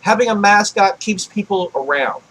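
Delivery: normal talking voice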